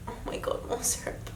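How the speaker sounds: breathy whispered amazement at the syrup; whispered gasp. Real, not acted. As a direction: whisper it like a secret shock.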